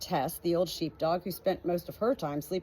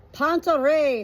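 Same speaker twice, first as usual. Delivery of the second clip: high, loud goat voices; loud high goat call; goat chorus; 8 semitones higher, 8 dB louder, slower than usual. Acted. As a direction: proclaim it like smug goats. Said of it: "said the goats at the fence".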